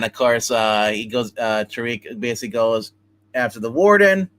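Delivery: ordinary talk, nothing marked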